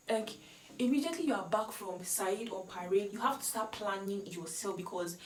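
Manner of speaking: normal talking voice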